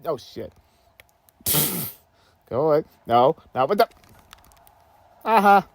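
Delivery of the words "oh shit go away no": usual speaking voice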